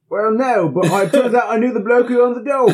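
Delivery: mock British accent. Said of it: "the British horror story".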